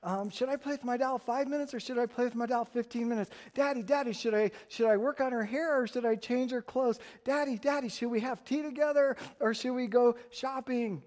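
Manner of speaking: put-on high, eager child voice; little girl. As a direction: pester daddy like a small girl. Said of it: "Imagine a little girl playing with her doll".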